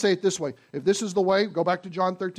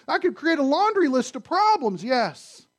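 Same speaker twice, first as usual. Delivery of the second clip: fervent preaching; 6 semitones higher than usual. Acted. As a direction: drive the point home to the congregation. Preaching at full force.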